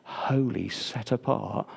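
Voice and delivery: hushed, soft reverent breath; barely breathed. Shown as a demonstration. Preacher breathing the Lord's Prayer to the congregation, hushed. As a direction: breathe the holy name gently. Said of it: "Breathe that, just barely breathe it".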